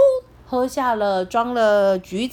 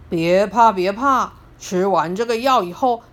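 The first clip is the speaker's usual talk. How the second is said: put-on lower, gentle old grandpa voice; kind grandpa; 6 semitones lower than usual. Grandpa calming the scared piglet, low soothing tone. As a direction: soothe him like a kindly grandfather.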